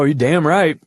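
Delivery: ordinary talk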